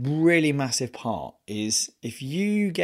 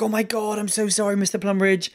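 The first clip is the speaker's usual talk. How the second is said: flustered nurse; 9 semitones higher than usual. Retold or imitated. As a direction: imitate the nurse scrambling to take it back. Nurse apologising in story.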